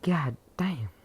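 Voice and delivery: a real whispered god damn; unvoiced amazed whisper at the time. Real, not acted. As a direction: breathe it out without voice. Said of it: "whispered aside".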